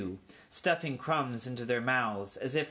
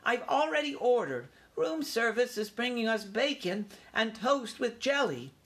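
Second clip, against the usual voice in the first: high-pitched, self-important announcement; young mouse showing off grandly; rather grand; 11 semitones higher than usual. A narrator's reading. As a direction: announce it with a flourish. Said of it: "adding rather grandly".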